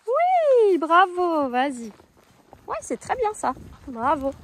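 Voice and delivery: oui jailli très haut et fort; féliciter avec joie; bravo enthousiaste. Real, not acted. Félicite la jument avec élan. (la cavalière acclame sa jument, très haut)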